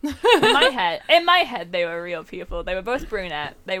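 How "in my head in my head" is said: high, loud laughing insistence. Real, not acted. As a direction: giggle while insisting.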